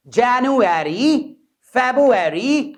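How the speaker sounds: slow drawn-out January, February; practice drill. Shown as a demonstration. Teacher leads practice drill, slow and clear. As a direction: drill it slowly. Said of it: "करें practice".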